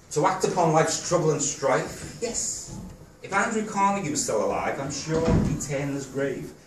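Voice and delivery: rhythmic spoken-verse delivery; poem recital. Real, not acted. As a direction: recite it line by line, pausing after each phrase. Reads own poem to audience.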